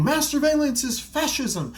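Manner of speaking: high, strained mock outrage; mock screaming. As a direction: scream it like a protester.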